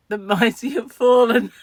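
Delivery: mock-tragic, laughing; mock lament, laughing. Vlogger laughing at her posh ex's decline, mock lament. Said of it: "I thought".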